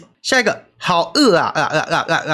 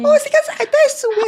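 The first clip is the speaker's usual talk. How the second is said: put-on baby voice; faster than usual. Shown as a demonstration. Keeps the baby voice.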